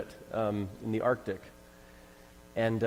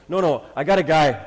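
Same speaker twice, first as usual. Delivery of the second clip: louder, higher eager retold quote; proudly insisting, voice raised; excited insistence; 4 semitones higher, 10 dB louder than usual. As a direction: burst out with an acted brainwave. Acted eager offer of help.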